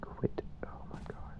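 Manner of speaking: barely audible, spooked whisper; frightened whisper. Real, not acted. Player telling the ghost to quit, frightened whisper. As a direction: breathe it out in a tiny whisper.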